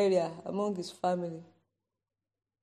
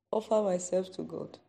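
ordinary talk, nothing marked

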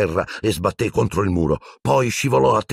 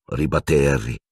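ordinary delivery, nothing marked

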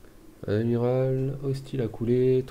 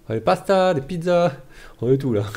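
mock English voice; 8 semitones higher, 9 dB louder than usual. The streamer mocking the English AI in a loud high voice. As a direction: ham up the English saying they love pasta too much.

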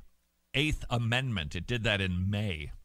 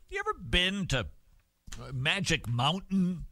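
ordinary talk

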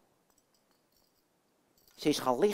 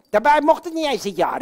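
ordinary talk, nothing marked

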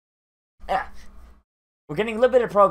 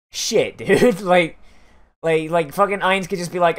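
normal talking voice

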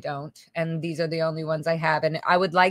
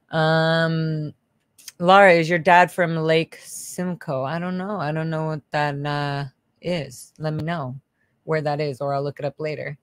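usual speaking voice

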